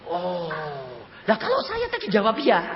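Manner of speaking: high re-enacted worried question; astonished, high-pitched query; dumbfounded. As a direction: ask as the danger dawns. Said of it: "saya melongo".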